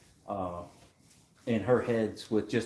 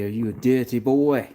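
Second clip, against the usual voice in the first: put-on higher, louder Geordie accent, quoting the old film; movie quote in a Geordie accent; 5 semitones higher, 6 dB louder than usual. Quoting an old movie to the host in a put-on Geordie accent. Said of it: "Good effort with the accent".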